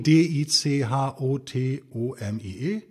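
slow, evenly spaced letters; spelling out. Shown as a demonstration. Guest spelling a term for listeners to google, slow spelling.

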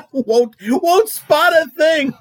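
slow, laughing through the words. Real, not acted. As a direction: crack up while repeating it. Repeats joke laughing.

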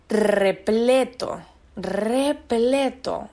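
slow emphatic "repleto, repleto"; drawn-out emphasis. Real, not acted. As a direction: stretch "repleto" out very slowly.

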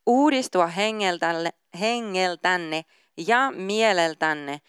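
measured, earnest Bible reading aloud; steady scripture recital with weight on key words. Acted. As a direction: read the passage deliberately, like a devotional. Reads Ephesians passage aloud.

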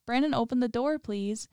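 understated frightened plea; growing fear. A narrator's reading. Zach begs Brandon to open the door, mildly fearful. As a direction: plead with a touch of fear. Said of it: "the fear in his voice growing".

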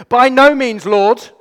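high, loud indignant protest; emphatic refusal. Shown as a demonstration. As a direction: throw the "no" back at God. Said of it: "I love the fact that he says this".

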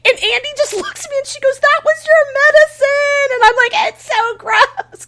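high, loud squeal through laughter; laughing retelling. Real, not acted. Telling friends about spat-out medicine, laughing squeal.

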